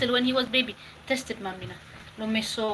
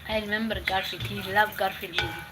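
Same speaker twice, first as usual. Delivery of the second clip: normal talking voice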